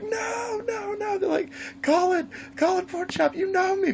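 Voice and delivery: high, frantic begging cry; pleading victim voice. Retold or imitated. Trapped player begging Jason to spare him, crying high. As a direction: squeal for mercy like a doomed camper.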